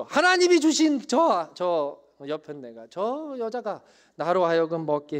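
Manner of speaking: slow, pointed blame; Adam blaming wife. Acted. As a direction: drag out the accusation. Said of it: "아담처럼". (여편네를 탓하는 아담, 느린 손가락질)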